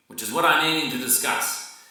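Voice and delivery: plain reading voice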